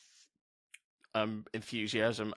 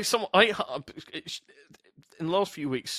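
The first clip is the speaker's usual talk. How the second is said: normal talking voice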